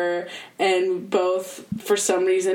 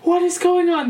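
tearful wonder; 8 semitones higher, 4 dB louder than usual. Retold or imitated. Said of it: "tears just streaming down my face".

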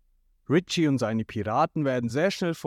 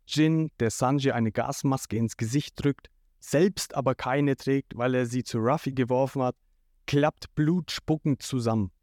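ordinary delivery, nothing marked

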